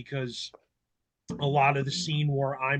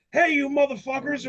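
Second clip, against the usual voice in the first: rocker yelling down; 9 semitones higher, faster than usual. Retold or imitated. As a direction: hail the fans from above, rowdy. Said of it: "he looks down and he's like".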